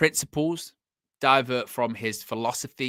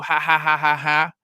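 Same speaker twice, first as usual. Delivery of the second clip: mock laugh; 3 semitones higher, 6 dB louder than usual. Mocking rival fans laugh.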